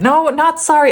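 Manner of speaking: playful defiance. Real, not acted. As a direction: snap back defiantly at yourself. Takes back her apology.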